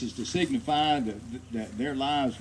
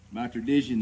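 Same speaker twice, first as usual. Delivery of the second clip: usual speaking voice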